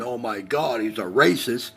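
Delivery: usual speaking voice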